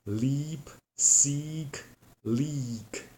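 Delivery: "leap, seek, leak" with a long tense E; long ee vowel drill; long E demo. Shown as a demonstration. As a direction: stretch the E in each word. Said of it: "So it's E".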